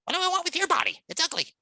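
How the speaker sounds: high, indignant mouse voice; manic little mouse. Acted. Mouse dismisses John's request for reassurance, manic. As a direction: squeak it with scornful disbelief. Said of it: "almost manic voice, just really energetic".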